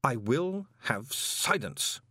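slow, measured authority; icy patrician order; cold command. Acted. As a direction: state it, no room for argument. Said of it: "Vetinari stated".

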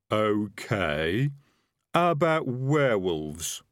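slow, deliberate highwayman checking items off; working through a list. A narrator's reading. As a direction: draw it out, moving to the next item. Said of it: "as though he was working through a list".

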